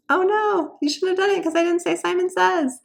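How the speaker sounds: high, loud teasing oh no; playful gotcha. Shown as a demonstration. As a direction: catch them out playfully. Teasing kids caught out in game.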